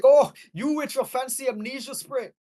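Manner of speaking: put-on hammy Scottish old man; lightly put-on Scottish brogue; Scottish accent. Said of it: "I'm doing a s-, a Scottish accent".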